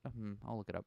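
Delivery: plain reading voice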